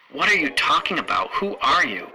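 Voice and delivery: high, loud indignant challenge; indignant matron. Acted. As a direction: demand who this intruder is. Matron confronts unknown intruder.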